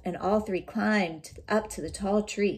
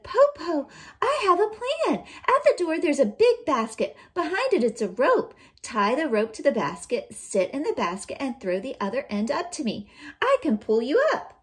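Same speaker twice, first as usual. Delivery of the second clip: put-on clever child; 5 semitones higher, 4 dB louder than usual. Eldest child with plan.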